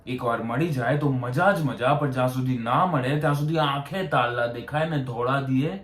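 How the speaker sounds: ordinary talk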